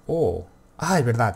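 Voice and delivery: ordinary talk, nothing marked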